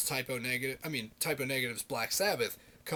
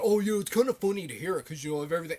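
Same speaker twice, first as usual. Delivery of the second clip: higher, louder mock voice; mocking quote; 3 semitones higher, 6 dB louder, faster than usual. Vlogger mocking the Amazing Atheist's opinion, higher and louder. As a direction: parody a critic you disagree with. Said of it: "The Amazing Atheist said".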